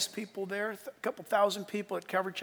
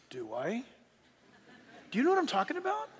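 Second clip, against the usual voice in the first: ordinary talk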